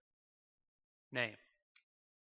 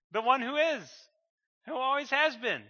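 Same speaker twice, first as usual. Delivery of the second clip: higher, louder preaching as Jesus; Jesus proclaiming; 7 semitones higher, 6 dB louder than usual. Retold or imitated. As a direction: proclaim it at full force, pleading to be recognized. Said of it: "And he keeps saying".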